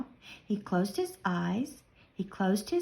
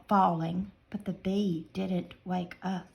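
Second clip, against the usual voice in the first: ordinary delivery, nothing marked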